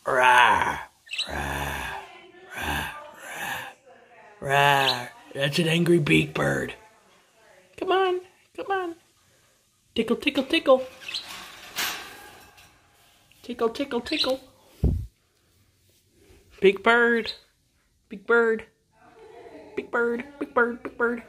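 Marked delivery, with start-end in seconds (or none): 0.1-5.1 repeated low play-roars; playful rawr. Pretend. Imitates an angry bird. 7.8-21.2 sing-song, pitched-up cooing; playful pet talk. Real, not acted. Teasing pet bird with tickles.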